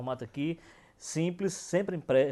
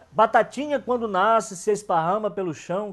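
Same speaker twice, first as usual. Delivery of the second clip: put-on higher, louder childlike rhyme recital; sing-song nursery rhyme; 4 semitones higher, 4 dB louder than usual. Shown as a demonstration. Teacher recites a children's rhyme, sing-song. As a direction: chant it like a kids' rhyme. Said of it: "E bem infantil mesmo".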